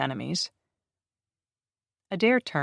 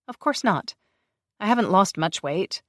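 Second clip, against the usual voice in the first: defensive; 6 semitones higher, 5 dB louder than usual. Acted. Grace denies defensively.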